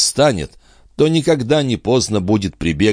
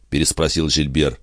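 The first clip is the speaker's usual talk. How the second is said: plain reading voice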